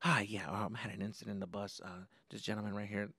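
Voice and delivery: low, hushed confidential report; whispering to principal. Retold or imitated. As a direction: report the incident discreetly under your breath. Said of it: "he's whispering to the principal".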